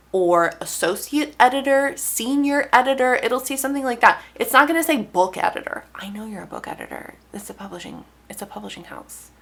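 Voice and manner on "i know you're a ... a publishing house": very soft mock aside; much quieter, under the breath; muttered aside. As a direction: drop to a hushed murmur, as if aside.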